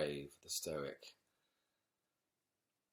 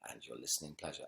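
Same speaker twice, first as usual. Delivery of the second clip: plain reading voice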